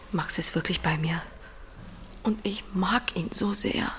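soft unvoiced whisper, tender; hushed secret confession. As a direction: whisper a secret you are shy about. Confessing feelings for Max.

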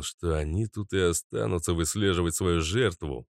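plain reading voice

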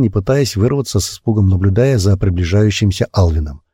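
ordinary delivery

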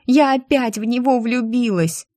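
high-pitched wailed outburst; wailing confession; crying out. A narrator's reading. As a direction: blurt it out in despair. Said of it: "выкрикнула Ира".

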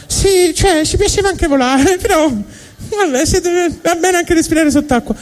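high-pitched squeaky fish voice, laughing sheepishly; a small fish backing down with a giggle. Acted. Fish replying to God, high squeaky giggling voice. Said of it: "E i pesci rispondono".

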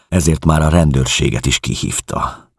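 ordinary delivery

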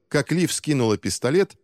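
usual speaking voice

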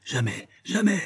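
high, strained protest; panicked defiance; desperate refusal. A narrator's reading. Moneylender refuses while being throttled.

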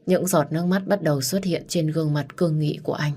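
usual speaking voice

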